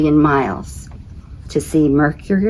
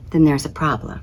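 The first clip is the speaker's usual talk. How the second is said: usual speaking voice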